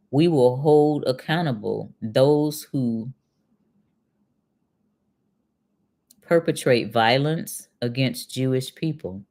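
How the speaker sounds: slow, deliberate reading, every word spaced out; read very slowly. Shown as a demonstration. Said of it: "I'm gonna read it very slowly".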